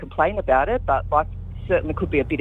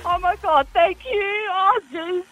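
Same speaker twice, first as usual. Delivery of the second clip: tearful joy; 12 semitones higher than usual. Real, not acted. Winner overwhelmed with joy.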